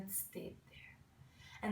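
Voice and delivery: slow, near-whispered wonder; soft, slow storyteller whisper; hushed narration. A narrator's reading. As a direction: let it sink to a slow hush. Hushed end of the story's climax.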